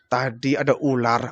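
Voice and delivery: low, soft, changed voice; faint admission. Acted. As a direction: admit it weakly, low and soft. Abu Bakar admits the snakebite. (pengakuan lirih dan rendah)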